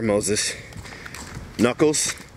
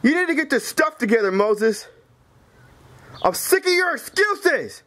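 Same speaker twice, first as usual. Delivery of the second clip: high, heated outburst; furious, pitched-up scolding; raging retort; 6 semitones higher than usual. Acted. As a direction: snap back, sick of excuses. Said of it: "Knuckles, you lost your mind".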